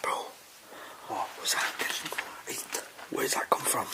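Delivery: tense hushed whisper; whisper, scared of being heard. Real, not acted. Whispering after a noise.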